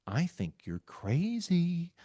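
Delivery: slow, soft, falsely patient calm with a breaking laugh; quiet condescending calm. Retold or imitated. Arguing with emotional people, mocking his own quiet calm. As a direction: handle them like a fragile child, gently. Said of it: "my default is to get real quiet and calm".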